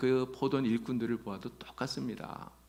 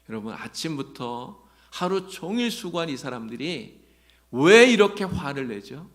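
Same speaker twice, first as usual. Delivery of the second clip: usual speaking voice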